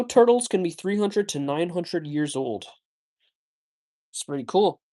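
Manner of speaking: ordinary talk, nothing marked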